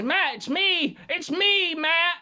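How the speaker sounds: high, hammed-up excited caller voice; mock Ralph. Mocking Ralph bursting onto the phone to Matt. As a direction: squeal it like an overeager caller.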